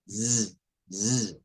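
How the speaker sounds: z held alone as a soft voiced buzz; vibrating z. Shown as a demonstration. Teacher demonstrating voiced s, lone buzzing z. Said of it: "S vibrante".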